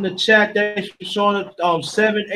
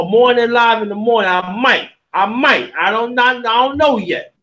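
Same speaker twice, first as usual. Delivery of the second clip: ordinary talk, nothing marked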